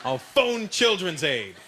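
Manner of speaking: slow, deliberate threat; slow bluff threat. Retold or imitated. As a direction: say it slowly as a bluff.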